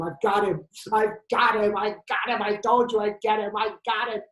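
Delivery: urgent, excited insistence; breathless triumph. Acted. Insisting to detective he caught him.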